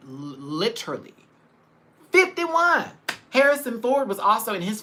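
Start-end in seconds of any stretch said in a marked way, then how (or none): 2.1-2.8 surprised. Real, not acted. Disbelief at actor's age.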